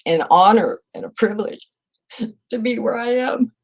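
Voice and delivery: a real tearful catch, pushing the words through; holding back tears. Real, not acted. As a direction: voice catching, fighting not to cry. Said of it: "I don't know why I cried".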